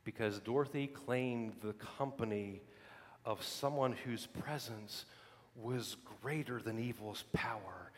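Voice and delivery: preacher lowering voice for weight; hushed preaching. Acted. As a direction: drop to an intimate hush. Quiet intense preaching passage.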